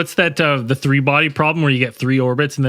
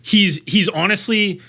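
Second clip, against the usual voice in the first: usual speaking voice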